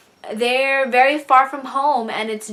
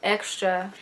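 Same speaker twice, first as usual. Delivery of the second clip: holding back tears; 7 dB quieter than usual. Real, not acted. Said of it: "I don't wanna cry on camera".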